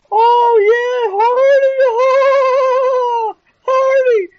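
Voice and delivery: hammed-up sobbing wail, way up high; mock crying fans. Mocking fans sobbing as Hardy comes out, high wail. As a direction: wail his name like a blubbering fan. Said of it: "That's when they cried".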